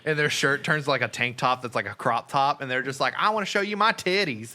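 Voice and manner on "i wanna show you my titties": high-pitched giddy quote; mock party girl. Women transformed by the chorus, mock giddy. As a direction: imitate a carried-away country girl. Imitating transformed country girls.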